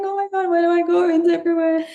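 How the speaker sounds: high, fast, giddy gush of wonder; overwhelmed excitement. Retold or imitated. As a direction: squeal it like a kid seeing everything at once. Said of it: "You're like".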